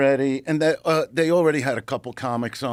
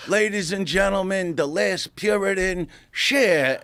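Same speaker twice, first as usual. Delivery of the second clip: higher, hammed-up emcee intro; announcer intro; 6 semitones higher than usual. Comedian announcing [name] to the crowd, hammed-up emcee. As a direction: build the name like a host. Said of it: "And I go".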